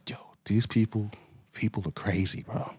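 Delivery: soft disbelieving whisper. Real, not acted. Aside to viewers about these people, whispered. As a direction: say it under your breath.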